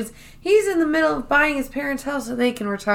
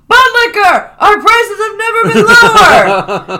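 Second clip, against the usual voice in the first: high, loud desperate sales yell, slower at the end; retold yell; 13 semitones higher, 10 dB louder than usual. Dwight screaming his pitch at the customer, loud and high. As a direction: bellow the sales pitch down the phone, half laughing. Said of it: "And Dwight yells".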